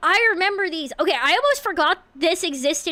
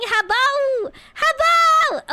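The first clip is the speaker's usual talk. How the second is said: cute squeal; 8 semitones higher, 4 dB louder, much slower than usual. Real, not acted. Cute greeting call.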